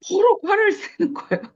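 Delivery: high-pitched, laughing through the words; laughing, pitch jumping up. Real, not acted. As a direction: tell it quickly with an incredulous laugh. Recounts client's outburst, laughing.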